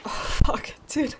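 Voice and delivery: breathless whispered curse under the breath; a startled, unvoiced curse; shocked whisper. Real, not acted. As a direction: swear in a sudden whisper. Water ruins the build.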